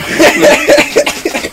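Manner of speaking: squealing, breathless laugh; high laughing fit. Real, not acted. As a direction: burst out in helpless high laughter. Cracking up at the coughing.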